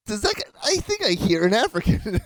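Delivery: high-pitched mock guesswork; teasing put-on high voice; mocking imitation. Mocks the other's guess, laughing.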